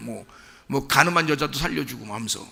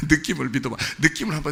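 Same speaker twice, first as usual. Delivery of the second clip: laughing; faster than usual. Shown as a demonstration.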